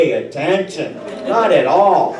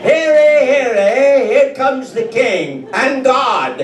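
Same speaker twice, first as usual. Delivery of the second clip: town crier; 5 semitones higher than usual. Pretend. Comic announcing the king to the crowd, mock herald. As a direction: proclaim it like a herald in the square.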